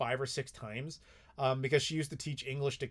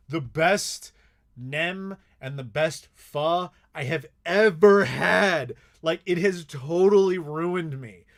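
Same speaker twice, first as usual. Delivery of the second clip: louder, slow, savouring every word; raving about the food; 8 dB louder, slower than usual. Real, not acted. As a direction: gush about food you can't forget. Raving about the best pho.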